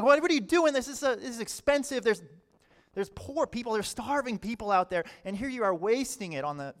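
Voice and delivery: high, loud outburst easing as it goes; indignant. Acted. Disciples objecting to a woman pouring costly perfume, indignant. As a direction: scold in indignant disbelief, starting high. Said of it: "They were indignant".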